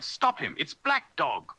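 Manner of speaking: high urgent call to stop him; boy shouting to stop a fleeing sailor, high and urgent; cry out. Acted. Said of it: "I cried".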